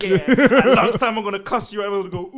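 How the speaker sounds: put-on loud reciting kid, laughing; mock schoolkid recital. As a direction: recite a rehearsed playground threat, loudly. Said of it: "reciting the lines that they say at school".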